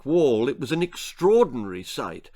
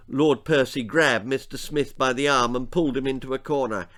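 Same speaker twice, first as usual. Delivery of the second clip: plain reading voice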